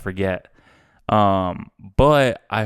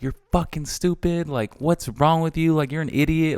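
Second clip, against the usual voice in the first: higher, scolding dad; mock scolding dad; 6 semitones higher than usual. Imagined dad scolding his son over losing the car, mock angry. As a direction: play an exasperated dad berating his son. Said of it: "so many dads would be like".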